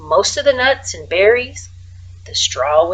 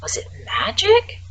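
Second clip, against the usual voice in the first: whispered wonder; 7 semitones higher, 3 dB quieter than usual. A narrator's reading. Wondering whether Frederick's voice is magic, whispered. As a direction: ask it under your breath, awed.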